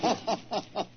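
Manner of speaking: a short, pleased chuckle; amused laugh. Real, not acted. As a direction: laugh quietly at the villain's nerve. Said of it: "What makes you laugh?".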